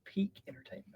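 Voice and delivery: dry whispered joke; quiet sarcastic aside whisper. Real, not acted. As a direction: whisper Peak entertainment.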